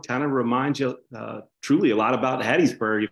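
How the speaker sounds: normal talking voice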